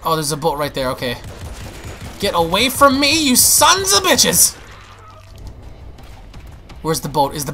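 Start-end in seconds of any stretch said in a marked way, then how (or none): 2.2-4.6 high, drawn-out angry cry; yelling at attackers. Real, not acted. Gamer fighting off attackers, yelling high. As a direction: fend them off, pitch climbing.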